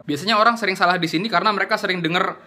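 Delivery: ordinary talk, nothing marked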